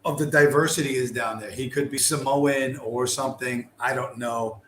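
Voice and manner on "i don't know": usual speaking voice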